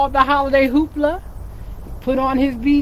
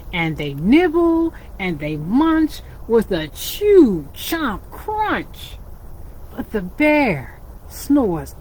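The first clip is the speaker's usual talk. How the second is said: lively storybook reading for little ones; animated picture-book narration; reading to children; 4 semitones higher than usual. A narrator's reading. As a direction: read it like storytime with kids.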